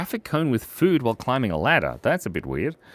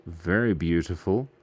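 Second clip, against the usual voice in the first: usual speaking voice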